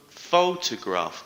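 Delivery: photograph drawn out slowly, punching pho-; stress on first syllable. Shown as a demonstration. Teacher modelling photograph for students, slow, stress on pho-. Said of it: "The stress is on the first syllable".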